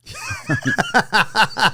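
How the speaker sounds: high, loud burst of laughter; big cackling laugh in a long run; real loud laugh. Real, not acted. Joker cracking up at his own remark, loud laughter.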